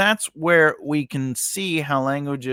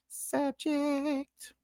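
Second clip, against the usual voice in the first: high, soft, slow drawn-out subject; sing-song classroom cue; 10 dB quieter than usual. Shown as a demonstration. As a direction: lilt it up playfully. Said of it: "teacher voices the word".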